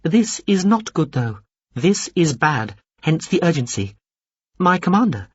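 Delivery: high, precise alien briefing; a small alien officer reporting dutifully; high formal alien. A narrator's reading. Alien officer briefs Veppers on the breach, high and formal.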